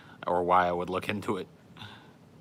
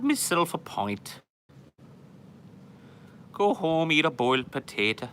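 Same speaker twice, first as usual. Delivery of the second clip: high, loud cartoon Irish lilt; mock Irish accent; 9 semitones higher, 4 dB louder than usual. Plays a stereotype Irishman.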